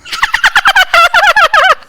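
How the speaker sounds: high shrieking witch cackle. Shown as a demonstration. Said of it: "please cue in three, two, one".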